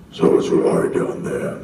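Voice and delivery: rough, loud unvoiced orc rasp; orc character voice. Shown as a demonstration. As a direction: rasp it out as a motion-capture orc. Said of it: "they would lower my voice by a full octave".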